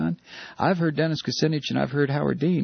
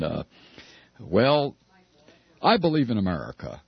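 halting cautious candidate; 7 semitones lower than usual. Shown as a demonstration. Candidate answering about Bush, low halting delivery. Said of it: "you should talk like this".

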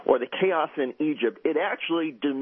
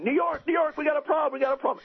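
high-pitched mock panic; reenacted frantic call, voice pitched way up; imitating panicked yelling. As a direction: yelp an urgent alarm to the control room, acted out. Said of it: "He immediately starts yelling".

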